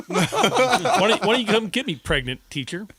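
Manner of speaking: high, loud laughing taunt; mock a cheeky five-year-old daring the teacher; bratty high laughing dare; taunting kid. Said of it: "He's like".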